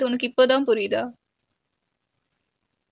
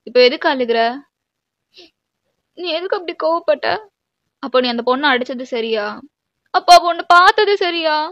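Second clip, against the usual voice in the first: loud, accusing questions; heated quarrel; 2 semitones higher, 8 dB louder than usual. Acted. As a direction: argue back, rising to an outburst. Heated quarrel about the slap. (கோபமான வாக்குவாதம்)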